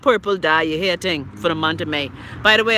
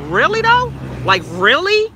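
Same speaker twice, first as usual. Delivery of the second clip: signature catchphrase voice; 9 semitones higher than usual. Acted. Host challenging leaders over the debt, in signature catchphrase voice. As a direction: pitch leaping high on an incredulous question. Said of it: "in my Chrissy Love voice".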